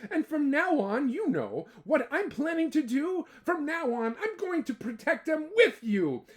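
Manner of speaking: high, earnest promise; kangaroo promising. Acted.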